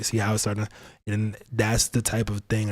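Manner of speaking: normal talking voice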